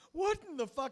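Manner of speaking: high, loud cry of dismay; retold tearful outburst. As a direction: reenact breaking down in shocked upset. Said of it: "I just started crying".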